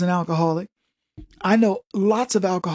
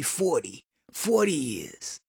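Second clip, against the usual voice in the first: deep, slow, softer smoker rasp; smoker lady voice; 4 semitones lower, 7 dB quieter, slower than usual. Retold or imitated. Edna answering Kevin, deep smoker's voice. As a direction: drag it out like forty years of smoking. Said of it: "her voice is deeper than mine".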